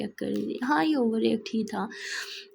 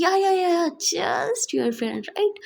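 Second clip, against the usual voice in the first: sarcastic disbelief; 6 semitones higher, much slower than usual. Acted. Neha mocks Barkha's just a friend line, sarcastic and high. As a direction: scoff at her girlfriend's excuse. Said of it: "Neha बोलती".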